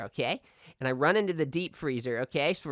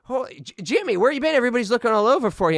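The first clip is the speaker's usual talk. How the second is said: higher, louder surprised call; surprised vice principal; 7 semitones higher, 4 dB louder than usual. Retold or imitated. Vice principal finding Jimmy, surprised and higher. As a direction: find the lost kid with relief. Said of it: "he's like".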